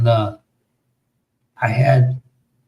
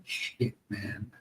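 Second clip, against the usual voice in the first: whispered, much softer swear under the breath; muttered curse; 4 semitones lower, 19 dB quieter than usual. Retold or imitated. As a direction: mutter it to yourself in a near whisper. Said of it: "and I'm going".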